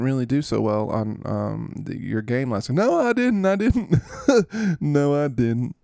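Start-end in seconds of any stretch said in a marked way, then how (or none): 2.8-5.8 high, louder put-on voice, laughing; laughing player voice. Jay Reed admits his bad game, laughing high. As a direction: giggle the admission. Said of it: "Mister Reed".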